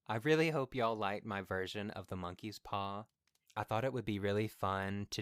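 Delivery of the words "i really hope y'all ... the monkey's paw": plain reading voice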